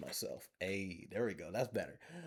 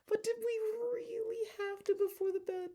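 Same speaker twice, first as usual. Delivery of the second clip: much higher put-on voice; silly high voice. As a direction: squeak the question in a silly high voice. Playful aside in silly voice.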